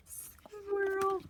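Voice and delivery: "Squirrel" said high and soft, cooing; cutesy squeal. Real, not acted. Repeats word cutely.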